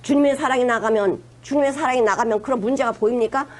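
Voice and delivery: ordinary talk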